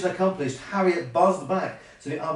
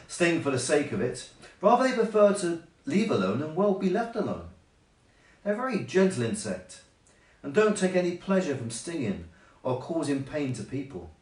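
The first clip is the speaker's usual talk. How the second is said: usual speaking voice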